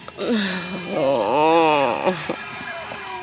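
a long, drawn-out tired sigh; weary sigh. Real, not acted. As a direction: let out all the strain in one sigh. Sick mother venting exhaustion.

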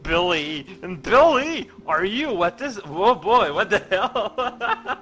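mother finding her son's mischief; hammed-up shocked mother. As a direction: play the outraged mom. Voicing mother in cutscene.

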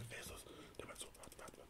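whispered exchange behind a phone call; barely audible, unvoiced-sounding whisper; hushed aside. Artist and manager whispering while a caller waits, whispered.

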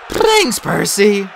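very high, loud, spluttering thanks; drenched satyr. A narrator's reading. Soaked satyr thanking his rescuer, high loud splutter. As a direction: call out thanks through a spout of water.

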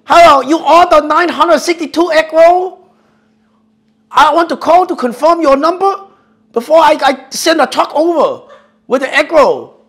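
high, loud put-on accent; fake Chinese accent. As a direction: prank caller's exaggerated accent. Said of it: "I'm like".

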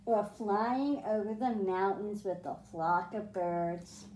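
put-on reading a picture book aloud to kids; storybook reading. A narrator's reading. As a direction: read it like a bedtime story for the class. Teacher reads picture book aloud.

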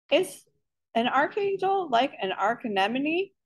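high-pitched schoolboy asking; lifted, lightly voiced child's question; curious kid question. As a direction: ask it high, like a puzzled pupil. Boy asks question in story.

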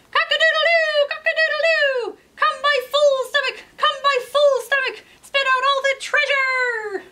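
high, loud rooster crow and shout; crowing rooster demand. Acted. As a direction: crow it out, then demand the button back. Rooster crows at home.

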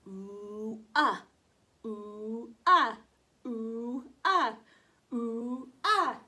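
teacher drilling U sounds; long and short U. Shown as a demonstration. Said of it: "It goes".

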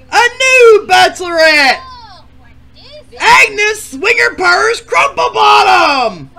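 high, loud game show shout; announcer intro. Acted. Host introducing the new bachelorette, loud announcer shout. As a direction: boom out the full name.

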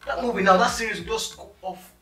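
exasperated outburst. Acted. Applicant complaining about leaving a movie, exasperated. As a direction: throw the advice back, loudly. Acted quarrel after being told calm down.

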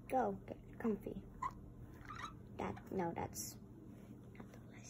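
quick hushed whisper. Real, not acted. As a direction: whisper it so nobody hears. Hushed instruction to toy.

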